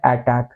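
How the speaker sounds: अटैक opened on "ae", as "AE-tak"; corrected first vowel. Shown as a demonstration. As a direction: give अटैक two matching "cat" vowels. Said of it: "तो ये होता है अटैक". (अटैक में 'अ' की जगह 'ऐ')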